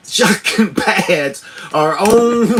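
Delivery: high, slowed words broken by laughter; jolted, laughing speech; laughing through shocks. Real, not acted. Talking while being shocked.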